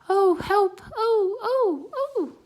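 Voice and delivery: very high, loud frightened crying; shrill loud panicked oh oh oh; terrified cries. Acted. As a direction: cry out in terror. Mouse terrified in the city.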